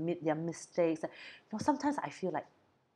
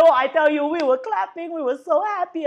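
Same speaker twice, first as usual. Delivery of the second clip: raised high and loud, bubbling over; gushing with delight as she recalls it; laughing, breathless joy; excited laughing retelling; 10 semitones higher, 11 dB louder than usual. Real, not acted. Laughing while retelling the scene.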